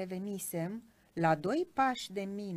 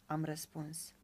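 plain reading voice

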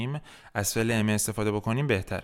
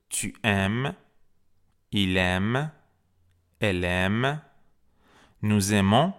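conjugation drill; 3 dB louder, slower than usual. Shown as a demonstration. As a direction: say each French form separately, pausing for repetition. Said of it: "با هم دیگه صرف کنیم".

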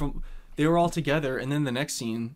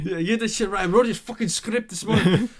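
put-on higher, louder Eastern European accent; mock Russian-ish accent; 8 semitones higher, 7 dB louder than usual. Mocking Andre on set with a loud Eastern European accent. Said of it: "That sounded like a Russian accent".

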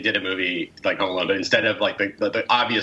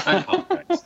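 much higher, squeaky sorry; high squeaky apology. Shown as a demonstration.

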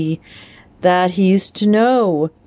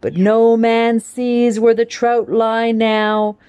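higher, lilting poetry reading; poem recitation; 5 semitones higher than usual. Acted. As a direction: perform the verse for the class, savouring each rhyme. Recites poem after title.

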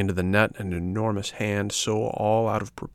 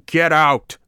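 higher, louder, barked order; threatening shout; 8 semitones higher, 7 dB louder than usual. A narrator's reading. Fisherman shouting at the hungry dog to leave, threatening. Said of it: "shouted the fisherman threateningly".

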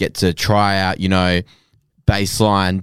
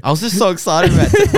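laughing; 11 semitones higher, 4 dB louder than usual. Real, not acted. Laughing confession about forgotten vinyls.

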